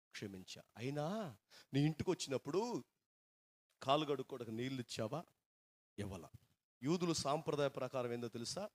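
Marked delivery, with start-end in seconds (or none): none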